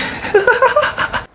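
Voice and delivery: high, breathy mock snicker; a sneaky high laugh from the back; imitated giggle. [name] watching the brawl, high mock snicker. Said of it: "[name] is standing in the back going".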